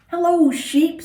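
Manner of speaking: high, bright-eyed duck greeting; high chirpy duck hello; cheery Duck. Acted. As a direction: call out high and friendly as Duck. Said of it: "said Duck".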